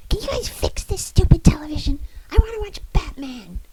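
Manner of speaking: put-on high, loud childish whine; whiny shout. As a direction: yell like a spoiled kid. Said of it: "he shouted".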